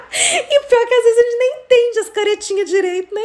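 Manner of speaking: high, laughter-filled voice; real amusement pushing the voice up; laughing while talking. Real, not acted. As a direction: talk through a fit of giggles. Laughs at own funny story.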